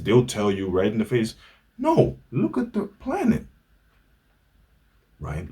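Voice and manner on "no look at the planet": mock high retort, way up in pitch; mocking refusal. Mimicking people who refuse kids, mock high retort. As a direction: toss it off like a scoffing skeptic.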